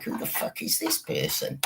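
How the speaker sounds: hushed disbelief. Real, not acted. Baffled aside about the stranger.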